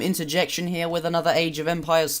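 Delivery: like a robot reading a script. Pretend. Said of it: "I sound like a robot".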